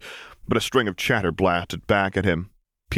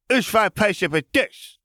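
put-on high, slow little child pronouncement; a tiny boy announcing gravely; solemn toddler; slower than usual. A narrator's reading. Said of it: "he pronounced".

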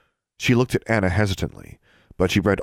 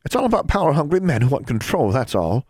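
higher, mildly exasperated teacher voice; frustrated; 8 semitones higher than usual. A narrator's reading. Teacher dismisses her pupil's interest in conspiracies, frustrated. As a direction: dismiss it with impatient emphasis. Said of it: "she asked in frustration".

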